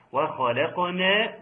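وخلقنا slow with a gentle lam and light kha; correct light reading. Shown as a demonstration. Teacher models the correct reading of وخلقنا, gently. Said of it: "برفق اللام".

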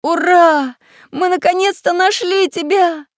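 high, loud cheer; overjoyed. A narrator's reading. Самоделкин finding his lost friend, overjoyed. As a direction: hurrah in delight. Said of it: "обрадовался Самоделкин".

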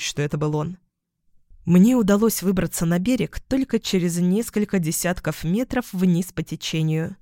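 usual speaking voice

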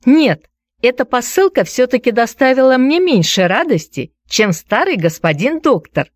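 put-on high, firm little girl's declaration; determined. A narrator's reading. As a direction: declare it with full conviction. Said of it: "заявила со всей решительностью".

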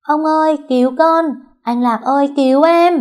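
high, loud cries for rescue; shouting for help. A narrator's reading. Girl fleeing the boys calls grandpa, shouting for help. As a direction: call out urgently to the whole street. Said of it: "Vừa chạy vừa hô to".

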